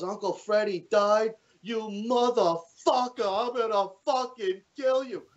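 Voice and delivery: high, drawn-out furious dad impression; enraged dad. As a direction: imitate a dad screaming threats down the phone. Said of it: "So the dad grabs the phone".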